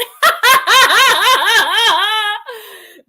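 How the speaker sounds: long, loud, high belly laugh; real uncontrolled laughter; hearty laugh. Real, not acted. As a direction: crack up in falling peals. Laughing at a viewer's joke.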